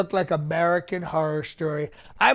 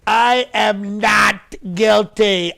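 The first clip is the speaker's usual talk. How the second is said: slow courtroom shout; 2 semitones higher, 6 dB louder, much slower than usual. Shown as a demonstration. Defendant shouting his innocence in court, slow and loud. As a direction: shout each word slowly. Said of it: "that's how you have to deliver it".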